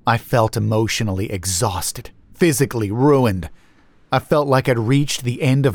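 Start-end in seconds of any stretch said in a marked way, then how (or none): none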